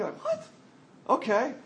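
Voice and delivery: mock knowing "what? okay"; smug knowing double take; suggestive smirk. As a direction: play the smirking innuendo guy, high. Imitating suggestive reaction.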